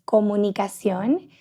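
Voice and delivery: "comunicación" said in Spanish; Spanish pronunciation. Shown as a demonstration. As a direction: switch to Spanish sounds for "comunicación". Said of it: "In Spanish".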